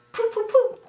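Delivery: loud, high little spits; mock spitting. A narrator's reading. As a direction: spit out three dainty puffs. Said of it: "she spit three times".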